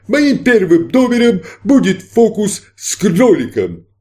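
high, slow stage announcer; showman magician. Acted. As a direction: announce the trick grandly.

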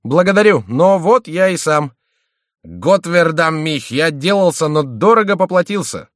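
high, loud, aggrieved outburst; worked-up, high-pitched grievance; indignant announcement. A narrator's reading. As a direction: complain at the top of your voice. Battered Schomberg announces himself angrily.

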